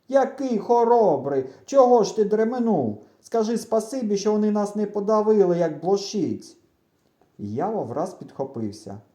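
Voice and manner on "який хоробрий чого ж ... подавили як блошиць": high, loud sarcastic scolding; indignant retort. A narrator's reading. Boy answering his friend's reproach, indignant retort. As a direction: snap back, stretching the mocking words.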